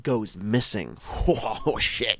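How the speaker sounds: ordinary talk, nothing marked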